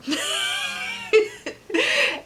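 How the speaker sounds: very high squeaky giggle; real high-pitched laughter. Real, not acted. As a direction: let out a long, high giggle. Laughs at own joke.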